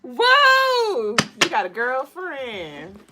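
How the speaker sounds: high whoa, then slow teasing; mock surprise. Streamer teasing over a card. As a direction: gasp and tease.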